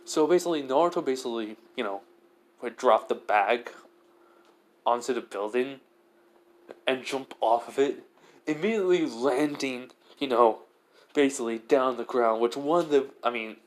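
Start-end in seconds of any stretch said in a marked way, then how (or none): none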